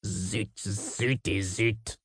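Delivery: very low-volume, slow grumble; hushed, drawn-out muttering to oneself; grumbling. A narrator's reading. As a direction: mutter a curse under your breath. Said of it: "grommela Taratsa".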